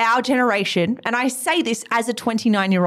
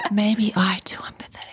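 whispering along in mock confession; hushed, unvoiced admission; playful whisper; 4 semitones lower than usual. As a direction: echo it back in a whisper. Echoes the whispered word back.

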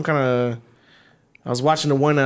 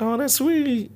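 put-on high girly voice; 12 semitones higher, much slower than usual. Mimicking girl who likes creepy line.

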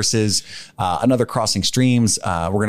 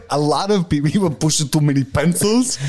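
mock leader speech; 6 semitones higher than usual. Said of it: "show me your leadership capabilities".

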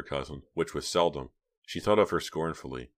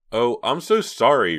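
slow, louder, overdone sorrow; fake upset; 7 semitones higher, 10 dB louder, slower than usual. A narrator's reading. Reva lying to her cousin, faking being upset. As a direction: put on sympathy she doesn't feel. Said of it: "making herself sound really upset".

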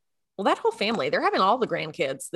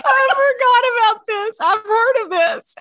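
high, loud squeal of laughter in the words; helpless laughter; 13 semitones higher, 6 dB louder, slower than usual. Real, not acted. Remembering friend's lie-down in the grass, squealing laughter. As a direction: squeeze the words out slowly while cracking up. Cracking up at friend's crying story.

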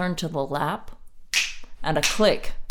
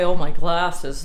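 imitating partner; 8 dB louder, slower than usual. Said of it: "Richard, you said today".

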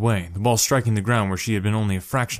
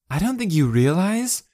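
threatening; 10 semitones higher than usual. A narrator's reading. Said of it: "her voice threatening".

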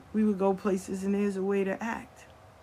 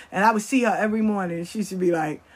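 ordinary talk

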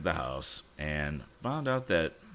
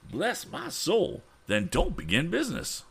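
crying out; 14 semitones higher, 3 dB louder than usual. A narrator's reading. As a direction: Bless my soul thrown up high in dismay. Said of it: "cried Mister Damon".

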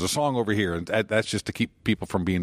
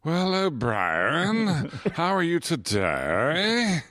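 very slow James Mason, swooping up and down; 6 semitones higher, much slower than usual. Acted. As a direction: stretch each word like James Mason. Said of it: "My James Mason is".

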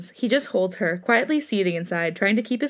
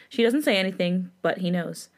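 ordinary delivery, nothing marked

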